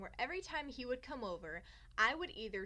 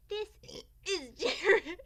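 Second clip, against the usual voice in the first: put-on reading kid's note; 7 semitones higher, 9 dB louder, slower than usual. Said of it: "I wrote".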